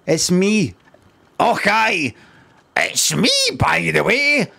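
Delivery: hammed-up Scottish Mario catchphrase; Scottish accent. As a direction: announce yourself in a broad Scottish accent, drawn out. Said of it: "I'd do a fucking Scottish accent".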